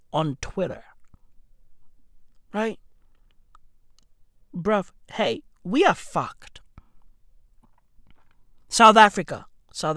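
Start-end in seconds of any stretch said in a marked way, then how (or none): none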